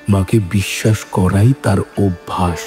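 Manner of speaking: ordinary delivery, nothing marked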